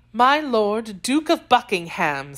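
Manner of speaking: ordinary delivery, nothing marked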